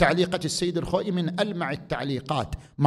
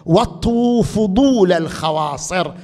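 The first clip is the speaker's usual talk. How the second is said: فضول said a little louder; stress on فضول; 2 semitones higher, 6 dB louder than usual. Shown as a demonstration. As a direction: lean on the word فضول. (تشديد على كلمة فضول)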